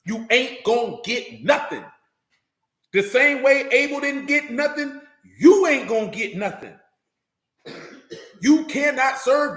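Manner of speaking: slow, hammered-out warning; emphatic preaching. Real, not acted. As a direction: drive each word home slowly, pitch lifted.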